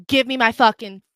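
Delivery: controlled, forceful order; firm angry demand. Retold or imitated. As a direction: demand it back, still contained. Acted demand, first take.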